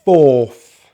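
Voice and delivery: "fourth" with lips pushed out on a long "or"; rounded lips. Shown as a demonstration. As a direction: say "fourth" loudly with pouted lips. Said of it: "you stick those lips out".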